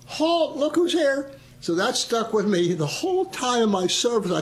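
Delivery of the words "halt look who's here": high yelled halt, look who's here; a mess hall teasing chant; hundred soldiers mocking him at breakfast; mock group holler. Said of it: "stand up and holler".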